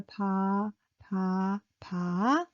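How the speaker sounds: low, gentle "pa"; soft, low, drawn-out "pa"; low pitched, soft. Shown as a demonstration. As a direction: say "pa" low with little force. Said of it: "low pitched, little and soft".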